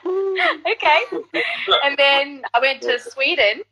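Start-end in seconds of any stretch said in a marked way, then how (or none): 0.1-1.0 high, impressed okay; overwhelmed little okay; playful stunned reaction; mock awed okay. Awed at gold records.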